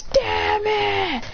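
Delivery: high, stretched cry, no real volume; retold frustrated yell. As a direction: draw it out high in mock frustration. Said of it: "And then yells".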